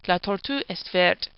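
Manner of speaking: French line read in a Spanish accent; a playful Spanish accent on French words. Shown as a demonstration. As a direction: say the French as a Spanish speaker would. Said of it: "I say that with a Spanish accent".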